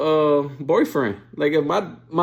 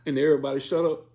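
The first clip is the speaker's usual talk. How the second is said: ordinary delivery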